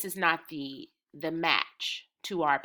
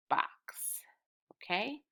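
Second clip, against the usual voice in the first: whisper; 4 semitones higher, 7 dB quieter, slower than usual. Real, not acted. Teacher's whispered aside.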